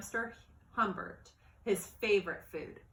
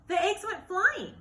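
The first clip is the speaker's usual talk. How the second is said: higher, louder, the eggs flying; excited narration; 11 semitones higher, 7 dB louder than usual. A narrator's reading. Narrator tells of the eggs flying, higher and louder. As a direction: build the alarm of the fall.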